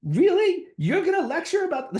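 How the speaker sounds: high and loud mocking disbelief; incredulous shock. Smoking stranger scoffs at his conference topic, high incredulous squeal. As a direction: squeal it like it's absurd. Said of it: "She, she goes".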